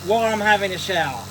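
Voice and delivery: high put-on voice, defensive; hammed-up prudish bather; mock indignant. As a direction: shoo the viewer out in character. Mock telling off viewer.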